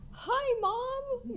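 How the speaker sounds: put-on high little-girl "Hi, Mom"; child's voice. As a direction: squeak it like a cheerful toddler. Mother voices toddler greeting.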